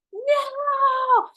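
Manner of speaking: high, loud, drawn-out wail; hammed-up tantrum cry; retold meltdown yell. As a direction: play up a friend's hysterical "no". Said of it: "like, fully loses her shit. She is like".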